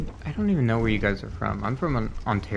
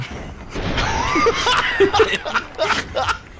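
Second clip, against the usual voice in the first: high, loud burst of laughter; laughing shout; 4 dB louder than usual. Real, not acted. Breaking down laughing mid-impression, loud laughing shout. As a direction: crack up loudly mid-bit.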